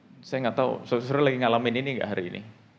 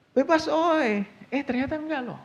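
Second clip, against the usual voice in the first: mock cheer; 12 semitones higher than usual. Mock cheer then letdown.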